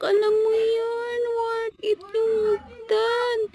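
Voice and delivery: high, loud, slow summit cry; triumphant shout. Real, not acted. Vlogger shouting from the mountain top. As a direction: belt it out at the top of the climb.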